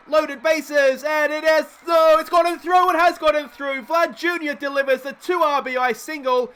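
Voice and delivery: bursting out as the winning runs score; excited shout. Real, not acted. Go-ahead hit in tight game.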